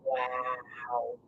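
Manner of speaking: hushed whisper of wonder, barely voiced; awed whisper. Real, not acted. Reader amazed by the cards, whispering wow to the viewer. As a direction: breathe the wows out in a soft, hushed whisper. Amazed reaction during card reading.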